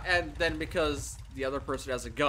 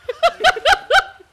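loud, high burst of laughter; real laugh; 12 dB louder than usual. Real, not acted. Player reacts to partner's crab massage joke, loud real laughter. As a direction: crack up at the joke.

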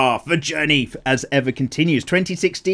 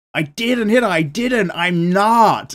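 higher, louder mock protest; ranting film character; 7 semitones higher, 5 dB louder, much slower than usual. Quoting a guy in The Room, a hammed-up raised protest. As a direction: ham up a defensive bad-movie rant. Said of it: "He's like".

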